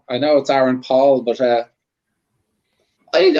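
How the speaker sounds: ordinary talk, nothing marked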